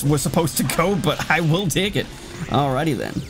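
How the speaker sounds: ordinary talk, nothing marked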